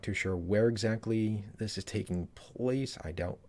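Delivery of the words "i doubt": usual speaking voice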